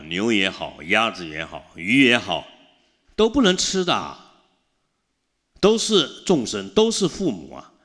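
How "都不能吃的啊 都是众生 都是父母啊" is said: 提高声调，逐字加重地劝诫; 像在讲台上恳切地劝大家戒杀; fervent preaching. Acted. Preaching at full force. (法师向信众激昂地劝诫不可吃众生)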